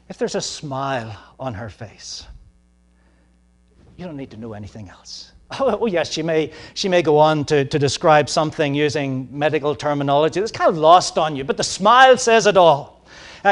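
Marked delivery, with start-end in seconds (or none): none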